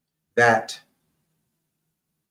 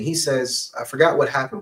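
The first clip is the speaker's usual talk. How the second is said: normal talking voice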